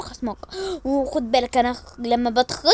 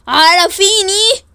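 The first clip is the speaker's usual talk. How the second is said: loud, slow demand; drawn-out demand; 6 semitones higher, 10 dB louder, much slower than usual. Acted. Sister loudly insists on being told. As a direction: stretch it out and insist.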